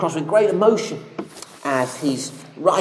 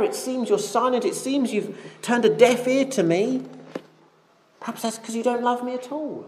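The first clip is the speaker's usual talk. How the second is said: mild panic; 6 semitones higher than usual. Acted. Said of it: "And David panics".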